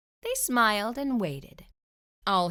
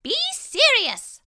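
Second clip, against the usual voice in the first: high, sharp and loud; sharp mouse voice; 9 dB louder than usual. Acted. Dentist scolding the fox, sharp high voice. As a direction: snap it in a squeaky voice. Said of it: "said the dentist sharply".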